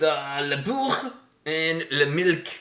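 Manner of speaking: hammed-up French accent, slow; Quebec French accent. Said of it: "my French accent is great".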